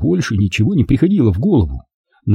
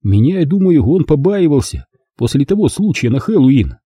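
ordinary delivery

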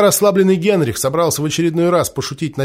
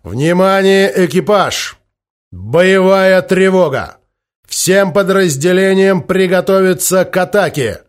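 loud, higher battle command; shouted order; 4 semitones higher, 5 dB louder, slower than usual. Acted. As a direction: bark the alarm to the crew. Captain orders crew via speaking tube.

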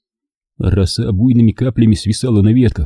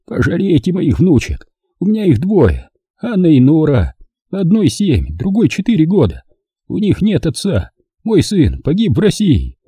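higher pleading voice close to sobbing; breaking into tears; 8 semitones higher than usual. A narrator's reading. Old man begs to return to his granddaughters, tearful plea. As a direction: beg for the granddaughters, near crying. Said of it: "Срывался он на плач".

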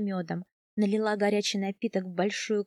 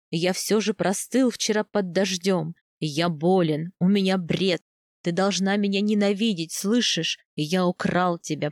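lowered male voice, insistent confession; husband, guilt-ridden; 3 semitones lower, 3 dB louder than usual. Acted. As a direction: confess firmly and intensely as the husband. (низкий голос мужа, покаянно и настойчиво)

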